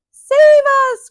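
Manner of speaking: very high, loud plea for help; desperate cry. A narrator's reading. Jailed pirates cry out to be saved, loud desperate plea. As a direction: scream for rescue from the cell. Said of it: "cried the pirates".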